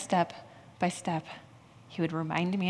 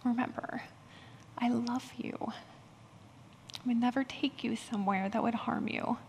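softer, whispered reassurance; gentle God voice; 3 semitones higher, 7 dB quieter than usual. Retold or imitated. God reassuring the frightened pastor, softly whispered. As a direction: hush it like a loving father calming a child. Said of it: "And he would say".

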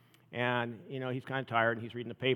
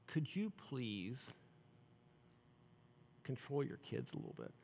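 polite request; 14 dB quieter than usual. Retold or imitated. Tired commuter politely asks the father to control his kids. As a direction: ask nicely, holding back irritation. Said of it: "turns to the guy really nicely".

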